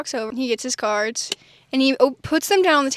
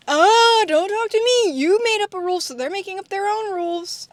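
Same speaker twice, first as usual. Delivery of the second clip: annoyed mom; 7 semitones higher, 3 dB louder than usual. Retold or imitated. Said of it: "Mom answers Dad at table".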